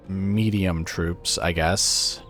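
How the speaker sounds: ordinary talk, nothing marked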